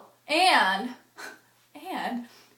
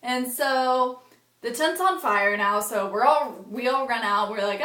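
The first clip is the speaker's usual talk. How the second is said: normal talking voice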